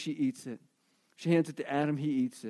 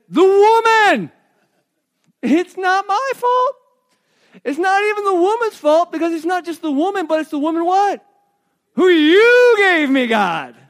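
Adam blaming; 11 semitones higher, 11 dB louder than usual. Acted. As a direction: shout the blame away like a sulking child. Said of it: "The man said".

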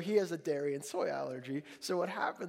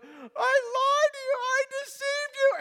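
very high, loud, slow bawling; mock tearful wail; 9 dB louder than usual. Son in tears confessing to father, hammed-up wail. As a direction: wail the confession like an overwrought child. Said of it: "And the tears started".

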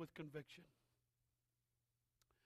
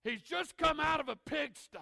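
ordinary delivery

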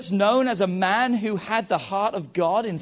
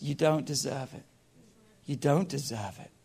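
hushed accusation; 7 semitones lower, 8 dB quieter than usual. Retold or imitated. The voice of condemnation, repeated.

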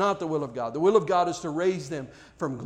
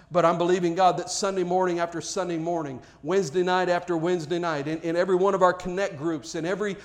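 preaching; 2 semitones higher, 5 dB louder than usual. Acted. Building call for revival.

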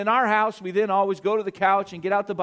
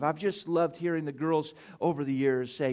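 usual speaking voice

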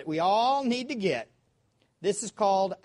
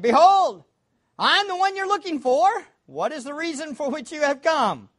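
loud proclamation; 11 semitones higher, 11 dB louder than usual. Acted. Peter's words acted out loud.

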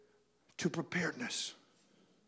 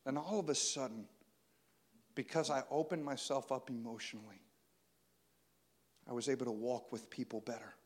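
soft preaching; 9 semitones lower, 13 dB quieter than usual. Acted. Intimate soft sermon passage.

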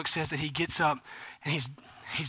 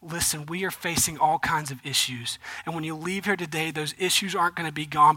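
ordinary delivery

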